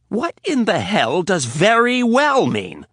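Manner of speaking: high, loud, slow outraged question; furious demand. Acted. Riley confronting Rapp, loud and outraged. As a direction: hurl it at him.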